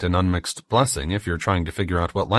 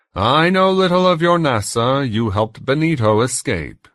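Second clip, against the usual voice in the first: put-on tormentor's higher-pitched German accent; 6 semitones higher, 5 dB louder than usual. A narrator's reading. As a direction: speak as a German interrogator, pitched up. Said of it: "The accent was German".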